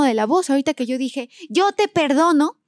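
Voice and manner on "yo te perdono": higher, slow, sulky forgiveness; pouty high "yo te perdono", drawn out slowly; tantrum voice. Shown as a demonstration. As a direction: forgive while throwing a little tantrum. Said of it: "como parece que estoy haciendo un berrinche".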